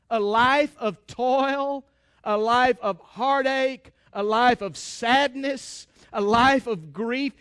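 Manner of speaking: each phrase drawn out slowly; slow, weighty preaching cadence; slow heavy emphasis. Acted. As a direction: lean on every life of. Preacher lists the hard life.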